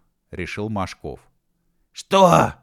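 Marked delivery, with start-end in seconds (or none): 1.9-2.5 high, very loud bellow; explosive indignant yell; outraged roar. A narrator's reading. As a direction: roar in disbelief. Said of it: "взревел У Ф".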